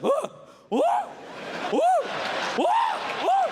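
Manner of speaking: very high, drawn-out woos; imitating whiny woo noises. Passenger three rows back on a shaky plane, whiny woos. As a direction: mimic a passenger's whimpers. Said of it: "these bitchy noises".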